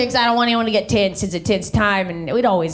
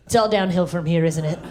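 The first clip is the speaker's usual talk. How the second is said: lower, fading deadpan; resigned child; 6 semitones lower than usual. Acted. Eight-year-old to the waitress, resigned and low. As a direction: say it low, sinking at the end. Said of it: "and you're like".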